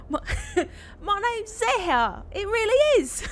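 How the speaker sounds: high, laughing Cockney voice; Cockney accent. Pretend. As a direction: laugh through a bad Cockney. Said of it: "worst Cockney accent you can".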